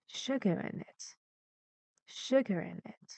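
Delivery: hushed demo of "Sugar in it" falling away; falling from stressed syllable. Shown as a demonstration. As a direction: quietly model the drop from "Sugar" onward. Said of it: "it goes lower and lower".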